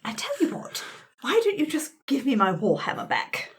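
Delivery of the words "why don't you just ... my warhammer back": ordinary talk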